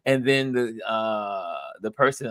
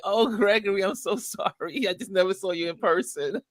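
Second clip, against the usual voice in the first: apologetic; 7 semitones higher than usual. Retold or imitated.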